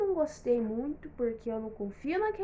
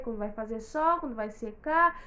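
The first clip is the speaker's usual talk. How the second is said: usual speaking voice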